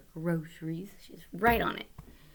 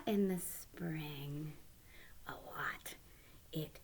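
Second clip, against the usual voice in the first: hushed whisper; 4 semitones lower, 11 dB quieter, slower than usual. Shown as a demonstration. Teacher whispering a secret to little listeners.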